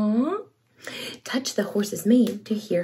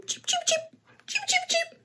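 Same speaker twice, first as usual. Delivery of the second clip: tiny high peeping chicks, much softer; chick cheeps; 10 dB quieter than usual. Acted. Reading to a child, voicing the baby chicks peeping. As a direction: peep softly like baby chicks. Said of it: "They say".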